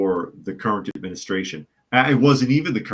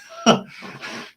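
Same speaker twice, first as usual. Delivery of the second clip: breathy laugh. Real, not acted. Laughing at whistleblower mix-up.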